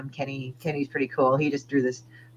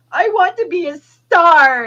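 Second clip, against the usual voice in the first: much higher, louder and slow, hammed-up diva; mock wannabe starlet; 14 semitones higher, 10 dB louder, slower than usual. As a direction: parody a vapid girl dreaming of fame. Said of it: "like, you know".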